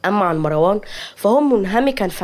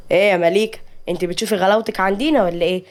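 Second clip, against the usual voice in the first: plain reading voice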